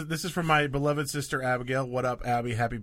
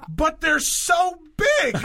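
much higher, louder, slow astonishment; mock astonished mom; 12 semitones higher, 5 dB louder, slower than usual. Reading sister's text, mom's shocked astonishment. Mom's quote read aloud.

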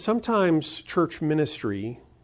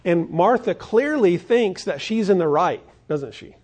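usual speaking voice

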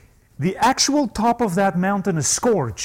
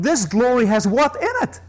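usual speaking voice

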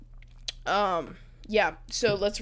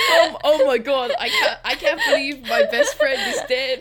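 much higher and louder, cracking up; laughing through lines; 8 dB louder than usual. Real, not acted. Brother breaking character mid-scene while laughing at his dead friend. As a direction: try to deliver the line while laughing. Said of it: "Don't break".

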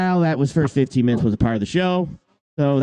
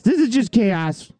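higher, slow, stretched-out despair; exasperated drawl; 7 semitones higher, slower than usual. Acted. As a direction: drag it out in mock despair. Repeats line, second drawn out.

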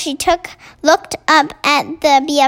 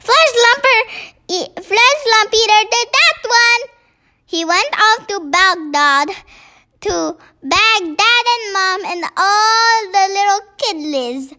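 BFG giant, eager; 7 semitones higher than usual. Acted. BFG explaining to the queen.

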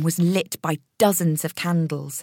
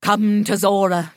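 summoning voice; 3 semitones higher, 4 dB louder than usual. Acted. Medium calling a spirit.

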